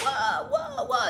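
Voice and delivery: high mock "whoa" babble; imitated loud chatter. Imitating loud Spanish talkers, exaggerated. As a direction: fire off high-pitched whoas. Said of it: "they were very loud".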